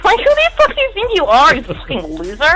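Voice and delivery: high, scornful and laughing; outraged. Real, not acted. Scorning the creep who wanted a lap sit, laughing and high. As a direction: tear into him with laughing scorn. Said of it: "outraged retort".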